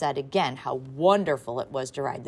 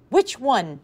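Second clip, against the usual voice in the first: excited; 8 semitones higher, 8 dB louder than usual. A narrator's reading. Flustered mother questioning.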